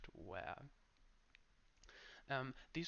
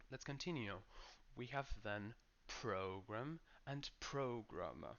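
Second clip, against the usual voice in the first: normal talking voice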